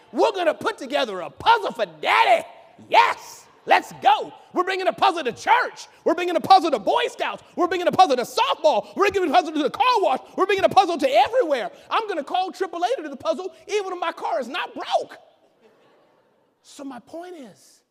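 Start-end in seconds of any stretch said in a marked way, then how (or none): 0.1-11.7 loud, high, gleeful family chant; excited kids. Retold or imitated. Family cheering over daddy's puzzle, loud and gleeful. 11.9-15.2 mock eager. Family eager to do puzzle.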